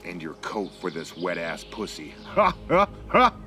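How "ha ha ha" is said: ordinary delivery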